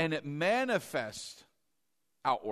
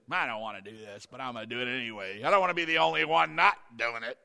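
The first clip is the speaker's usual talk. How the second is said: low, reluctant grumble; grudging giver; 6 semitones lower, faster than usual. Retold or imitated. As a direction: sulk as you give. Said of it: "or a sad giver".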